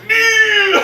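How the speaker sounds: sudden high, loud bellow; mock villain cry. Actor trolling just before the cut, sudden bellow. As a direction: burst out with a trolling yell. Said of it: "he would've trolled the scene".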